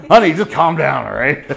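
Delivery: loud patronizing "calm down" to a spouse; teasing husband soothing his wife the wrong way; mock condescending spouse. Mock spouse quarrel quote.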